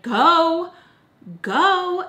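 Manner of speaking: high, loud yells of "go"; jeering shout. Retold or imitated. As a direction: bark it like rowdy boys chasing her off. Boys yelling at her to leave.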